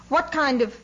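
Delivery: officer's sharp question, cut short; indignant rebuke. A narrator's reading. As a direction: start scolding, then stop dead.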